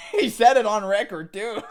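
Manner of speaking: laughing in disbelief, loud and high; can't believe he admitted it, laugh through it. Real, not acted. Laughing loudly at a guy who admitted nepotism on record.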